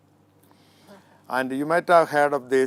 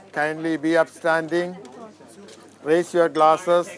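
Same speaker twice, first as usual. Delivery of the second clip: raised, formal toast to the couple; wedding toast speech. Real, not acted. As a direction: lift it up for the toast.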